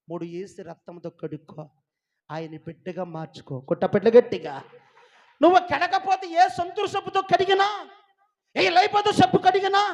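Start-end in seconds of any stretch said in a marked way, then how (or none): 5.4-9.8 high, loud pulpit shout; impassioned preacher at full volume; full-force preaching. Acted.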